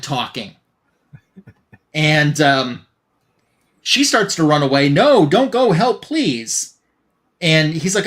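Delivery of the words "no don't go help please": higher, half-hearted cry for help; mock pleading. Severed head begs the fleeing heroine to stay, mock pleading. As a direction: call after her in a mild mock plea.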